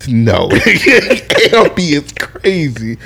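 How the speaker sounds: ordinary talk, nothing marked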